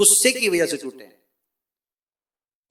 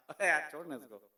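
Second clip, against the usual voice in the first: much softer, lower easygoing dismissal; calm dismissal; 8 semitones lower, 13 dB quieter than usual. Acted. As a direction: shrug it off gently. Calm man lets it go.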